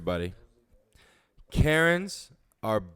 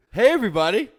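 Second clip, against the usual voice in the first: 1 loud host greeting; 7 semitones higher, 10 dB louder, much faster than usual. Real, not acted. Hosts greeting viewers loudly.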